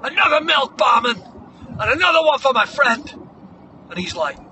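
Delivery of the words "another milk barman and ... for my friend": loud, high, triumphant call to the barman; mock-heroic shouted order. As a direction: holler your order across the bar. Acted bar order called out.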